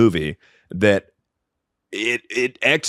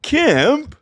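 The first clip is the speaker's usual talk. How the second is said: a sudden high, disbelieving yelp; can't believe a friend wrote that; shocked disbelief. Real, not acted. Reacting to Kemp's harsh review, shocked high disbelief. Outraged at a one-star review.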